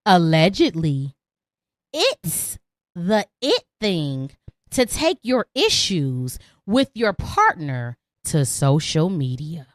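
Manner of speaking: low, slow announcer voice for the segment title; dramatic intro. Acted.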